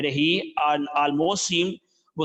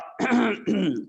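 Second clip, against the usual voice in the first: a demonstrated throat clear; 5 semitones higher than usual. Shown as a demonstration. Tailor clears his throat before naming the price. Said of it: "cleared his throat".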